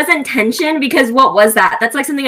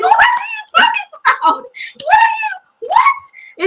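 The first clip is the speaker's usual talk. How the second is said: baffled outburst; slower than usual. Retold or imitated. To the book's heroine, a shrill baffled yell. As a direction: shriek in disbelief at the hair line. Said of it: "And I was like".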